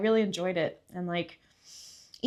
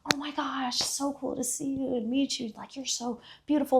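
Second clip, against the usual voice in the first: high, admiring fan voice; imitated gushing; 6 semitones higher than usual. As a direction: gush lightly in a raised pitch. Voices locals gushing at a foreigner.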